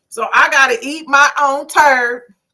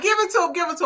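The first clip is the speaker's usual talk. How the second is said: high cheering "Give it to him"; urging her on from the couch; 8 semitones higher, much faster than usual. Retold or imitated. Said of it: "I was like".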